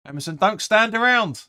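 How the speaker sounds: high, loud frustrated yell; shouting at the player. Real, not acted. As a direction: shout at him to get moving. Yelling at player on screen.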